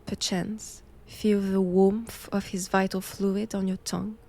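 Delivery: ordinary delivery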